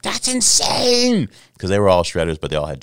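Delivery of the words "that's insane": high, loud, drawn-out roommates' cheer; amazed shout. Retold or imitated. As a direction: yell it stretched out, voice way up. Roommates' retold amazed reaction.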